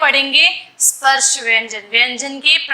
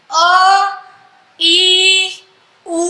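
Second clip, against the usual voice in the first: अ, ई, उ each dragged out for three beats; very stretched pluta vowels, slow and held; extra-long vowels; much slower than usual. Shown as a demonstration. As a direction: hold every vowel as long as a chant. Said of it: "प्लुत स्वर को हम थोड़ा सा ज्यादा खींचते हैं".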